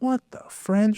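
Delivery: stunned disbelief. Retold or imitated. His stunned reaction retold.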